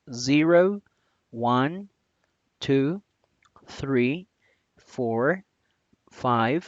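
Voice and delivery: each number said clearly, one by one; English numbers. Shown as a demonstration. Teacher counting in English for students. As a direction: count to twenty for the class. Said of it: "a pronunciar los números en inglés".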